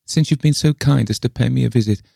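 put-on high, plaintive old voice; relenting old lady. A narrator's reading. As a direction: concede reluctantly in a high voice. Le Fane relents.

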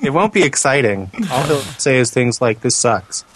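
ordinary talk, nothing marked

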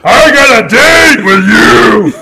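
high, loud, laughing call to Jeremy; teasing shout. Real, not acted. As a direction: yell it out teasingly, drawn out. Teasing friend, calling out across room.

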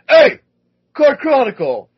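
big dude hollering; shouting across. Retold or imitated. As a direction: call out across the beer garden. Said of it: "this gigantic dude goes".

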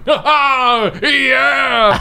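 high cheesy parade whoop; parade screaming. Retold or imitated. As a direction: whoop it up like a costumed mascot. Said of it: "me in the parade screaming".

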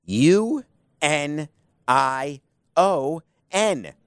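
each letter spelled slowly and separately. Shown as a demonstration. Host spelling out the union to listeners, slow and deliberate. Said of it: "spells out the word".

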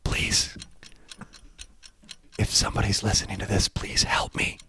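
whispered, desperate plea for help; pleading captive Joanna. Pretend. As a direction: beg a stranger for rescue in a whisper. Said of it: "give me an impression of Joanna".

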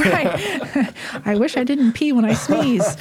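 amused, laughing while listing; light laughing confession. Real, not acted. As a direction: giggle through the complaint. Joking about body complaints.

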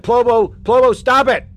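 yelling at Plobo to stop the voice; real yell. Real, not acted. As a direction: shout him down to make him stop. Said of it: "Why are you yelling at me?".

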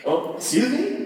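high, rising puzzled question; mock bafflement. Outsider hearing New York slang, mock baffled. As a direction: play taken aback, lifting sharply at the end.

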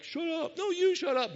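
very high-pitched cartoon squabble; comic goats hollering at their echo; imitated yelling goats. Movie goats up the mountain yelling at each other, imitated yelling goats. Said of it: "They're yelling at their echo".

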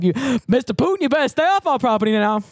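high-pitched Southern warning; indignant Southern landowner, hammed up; mock Southern accent. Georgia local warning Putin off the land, mock Southern voice. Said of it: "we're like".

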